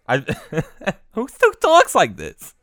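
high, loud, incredulous laughter in the words; laughing disbelief. Real, not acted. Host cracking up at the guy's way of talking, laughing.

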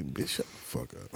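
very soft muttered insult; under the breath. Retold or imitated. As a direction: say it barely aloud. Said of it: "you gon' say".

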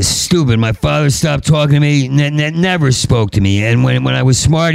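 ordinary delivery

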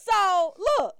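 usual speaking voice

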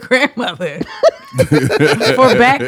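high, loud, laughing through the words; incredulous laughing rant; laughing in disbelief. Real, not acted.

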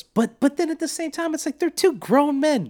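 worked-up, high and loud; excited rant. Real, not acted. Heated rant about the movie.

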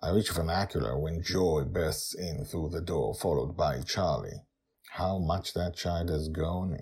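plain reading voice

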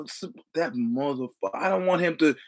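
usual speaking voice

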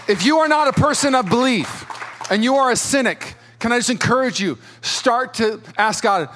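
high, loud, driving sermon climax; full-force preaching. Acted. Pastor urging the congregation to have faith, full-force preaching. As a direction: preach it hard over the band.

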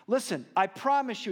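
animated retelling of a stolen-laundry tale; imitating Vic. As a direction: play the homeless friend spinning his sob story, high and loud. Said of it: "And he goes".

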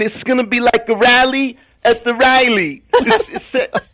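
high, eager Danny Zuko voice; John Travolta as Danny Zuko. Pretend. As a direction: gush like a starry-eyed teen greaser. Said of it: "Can you do it right now?".

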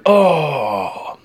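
high, drawn-out crowd "ohhh"; crowd's groaning "oh". Retold or imitated. Camper retelling the room's reaction to the failed catch, drawn-out crowd groan. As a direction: imitate a whole room groaning at a miss. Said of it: "the whole room went".

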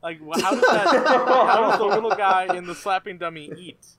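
loud question through laughter; laughing bafflement. Real, not acted. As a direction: crack up while demanding an answer. Baffled question while cracking up.

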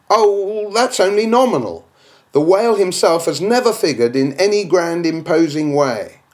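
a scoffing imagined critic; objector's voice. Acted. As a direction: brush it off as the doubter.

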